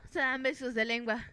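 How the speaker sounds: ordinary talk, nothing marked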